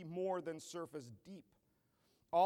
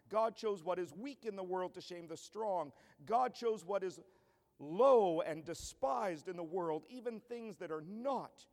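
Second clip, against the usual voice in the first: scripture reading; 5 dB louder than usual. Acted. Preacher reading Paul's letter to the congregation, building force. Reads 1 Corinthians aloud.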